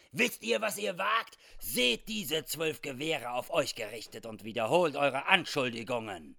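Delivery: loud armed threat, starting high; threatening. Acted. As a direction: dare him to repeat the accusation. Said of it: "fragte drohend".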